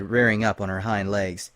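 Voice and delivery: plain reading voice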